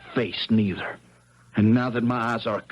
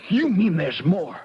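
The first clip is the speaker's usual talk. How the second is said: surprised; 7 semitones higher, 4 dB louder than usual. Acted. Boy hears of more trouble.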